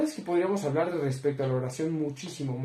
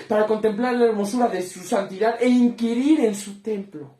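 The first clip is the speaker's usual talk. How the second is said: rising, louder and higher preaching; fervent psalm recital; 6 semitones higher, 6 dB louder than usual. Acted. Preacher proclaiming Psalm 27 to his congregation, building loud. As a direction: swell into full fervor.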